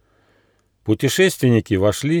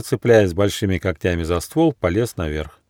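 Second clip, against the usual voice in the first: ordinary delivery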